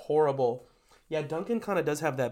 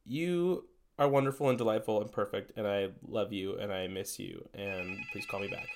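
mock love note; 5 semitones lower, 6 dB quieter than usual. Mock love message to Krispy Kreme.

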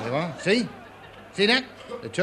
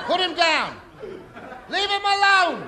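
high, loud slow shout; alarmed yell; 8 semitones higher, 6 dB louder than usual. Acted. Manager yelling at Miss Pugh to drop his wrestler, alarmed shout. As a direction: stop her hurting his wrestler.

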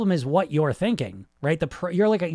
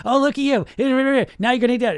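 high, louder taunting; mean inner voice; 8 semitones higher, 5 dB louder, faster than usual. Acted. Inner critic catching him with the wrong food, taunting. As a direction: jeer like an inner critic, pitched up and mocking. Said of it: "I still have that shitty voice".

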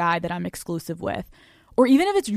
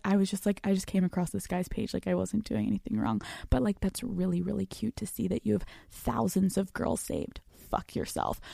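mock innocent; 7 dB quieter than usual. Girlfriend feigning innocence to boyfriend, soft retort.

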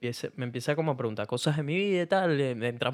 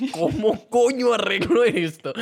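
desesperación fingida; 6 semitones higher, 7 dB louder, slower than usual. Retold or imitated. Pensamiento desesperado tras meter la pata.

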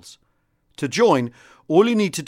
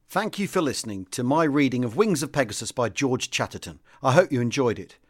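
plain reading voice

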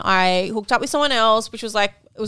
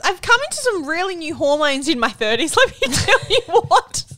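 laughing; 10 semitones higher, 4 dB louder than usual. Real, not acted. Laughing off her crying.

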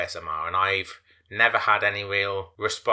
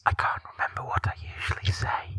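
ASMR whisper. Acted. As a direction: whisper close and gentle. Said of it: "using your A S M R voice".

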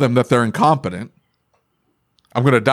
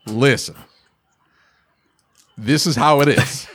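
measured, authoritative dad voice with a pause after "Listen"; assertive. Shown as a demonstration. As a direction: lay it down firmly without yelling. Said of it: "it is an assertive, authoritative".

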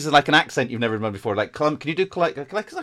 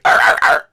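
loud imitation dog bark; 14 dB louder than usual. Shown as a demonstration. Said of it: "or they're like".

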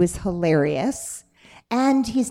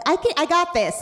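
cocky actor; 9 semitones higher than usual. Retold or imitated. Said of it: "People coming in with".

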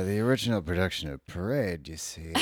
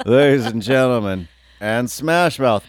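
loud announcer; 4 semitones higher, 9 dB louder than usual. Retold or imitated. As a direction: announce it to the crowd. Announcer parody.

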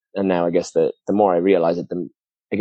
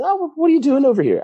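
high-pitched imitation of a surprised compatriot; playfully mimicked astonishment; mock surprise; 3 dB louder than usual. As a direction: pitch it way up, nosy and amazed. Mimicking Irish customer's surprised question.

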